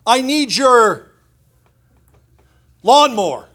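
put-on high, loud kid voice calling Daddy-o; pushy kid asking dad. Kid asks his dad for the lawnmower, loud and high. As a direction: drawl the need like a cocky teenager.

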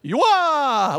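hammed-up confused reading, leaping up in pitch; baffled sounding-out. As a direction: grope at an unpronounceable word. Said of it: "We don't know how to pronounce that".

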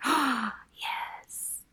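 breathy awed whisper after a gasp. Retold or imitated. Podcast cohost reliving the scene, awed whisper. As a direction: hush it out like you can't believe it. Gasped reaction to favorite scene.

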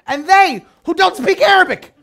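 usual speaking voice

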